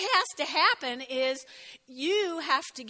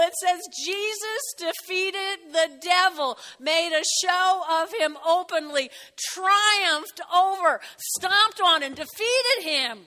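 loud, driving sermon delivery; emphatic preaching; 4 semitones higher, 6 dB louder than usual. Real, not acted. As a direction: proclaim the victory forcefully. Preaches triumph over the devil.

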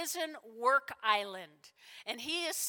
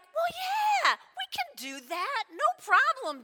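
high, loud, overeager yes; cocky brothers; 12 semitones higher, 4 dB louder than usual. Acted. James and John answering Jesus, cocky and eager. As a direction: agree breezily with total overconfidence. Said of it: "And they're like".

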